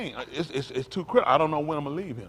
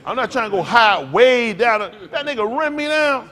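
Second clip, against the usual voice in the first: loud and higher, getting carried away; excited storytelling; 7 semitones higher, 9 dB louder than usual. Real, not acted. Preacher to congregation, recalling playground tag, louder and excited. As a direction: relive the game with rising excitement.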